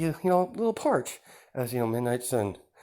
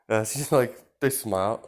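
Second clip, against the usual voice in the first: usual speaking voice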